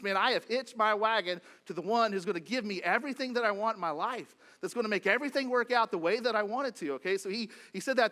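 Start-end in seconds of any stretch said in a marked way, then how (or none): 0.0-6.9 loud, higher eager thought; dreaming of everything he wants; excited inner voice. Retold or imitated. Peter imagining Jesus will give him everything, loud and eager. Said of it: "He thinks".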